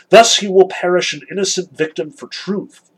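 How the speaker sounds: Kolya exclaiming. A narrator's reading. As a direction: proclaim it like an ardent boy, lightly acted. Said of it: "exclaimed Kolya".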